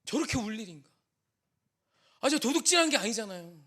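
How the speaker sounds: ordinary talk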